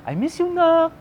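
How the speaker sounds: high, plaintive imitation of a mom; teary mom. Mom ending a phone call with her son, imitated teary longing. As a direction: put on a mother's high, wistful farewell. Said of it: "natiri-eye siya".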